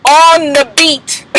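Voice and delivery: slow, one syllable at a time; on the beat. Shown as a demonstration. Recapper coaching the star to clap it out, slow and rhythmic. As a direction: punch each syllable like a clap. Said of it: "claps on every single syllable".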